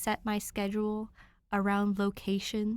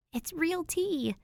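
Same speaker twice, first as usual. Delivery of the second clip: higher, slow, pleased little voice; a touch of delighted girl voice; 9 semitones higher, slower than usual. Acted. Danny delighted with the tea, lightly voiced. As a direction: savour the discovery, lightly voiced. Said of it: "hums approvingly".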